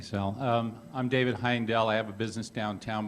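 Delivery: usual speaking voice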